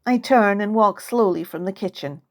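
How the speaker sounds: usual speaking voice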